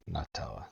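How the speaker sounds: Nutella as NUT-ella, softly restated; nut pronunciation. Shown as a demonstration. American restating his point, "Nutella" as "nut-ella". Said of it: "It's made from fucking nuts".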